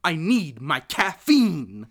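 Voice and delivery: put-on milk every word like a spoiled man-child; whiny grown son. Grown son begging his mother for caffeine, slow loud whine. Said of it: "his delivery on this is great".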